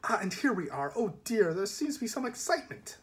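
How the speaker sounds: high, lilting mild dismay; daintily cheerful villain; breezy polite villain. Acted. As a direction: chirp politely at trouble ahead. Passenger character notes the excitement.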